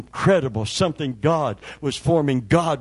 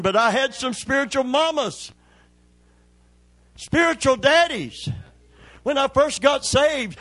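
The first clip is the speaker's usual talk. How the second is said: full-force preaching; 8 semitones higher than usual. Acted. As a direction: preach it up to the rafters.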